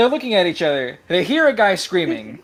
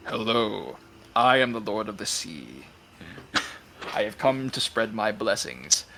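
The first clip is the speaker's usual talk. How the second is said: lower, slow sea god voice; deep muffled sea monster; 7 semitones lower, 6 dB quieter, slower than usual. Acted. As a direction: speak as a grand sea dragon. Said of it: "You open the door".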